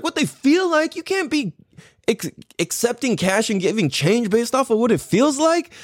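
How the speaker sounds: very high, disbelieving echo; incredulous retort. Retold or imitated. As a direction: scoff at the absurd excuse, pitch soaring.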